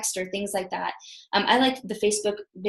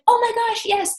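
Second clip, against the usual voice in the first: excited woman; 12 semitones higher than usual. Retold or imitated. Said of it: "She's like".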